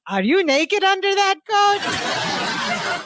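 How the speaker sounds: put-on childlike blurt, high and drawn out; slow, high-pitched innocent question, hammed up; Balki. Balki breaks an awkward silence, blurting like a child. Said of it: "a child would actually just blurt that out".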